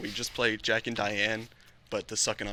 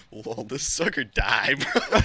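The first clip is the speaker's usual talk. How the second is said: laughing; 6 dB louder, much slower than usual. Retold or imitated. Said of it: "I'd just be like".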